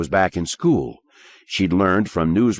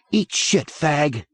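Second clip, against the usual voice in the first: defiant insult; 8 semitones higher, slower than usual. Acted. Boy defies teacher.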